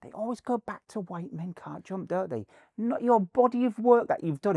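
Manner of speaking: high-pitched mock indignation; high, put-on exasperation; retold protest. Voices his complaint to the actor.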